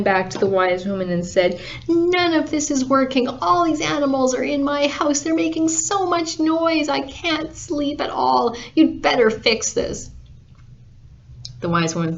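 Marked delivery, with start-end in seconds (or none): 1.9-10.1 higher-pitched, exasperated rant; acted sleepless exasperation; fed-up complaint. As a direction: whine insistently and demand a fix. Fed-up farmer confronts wise woman.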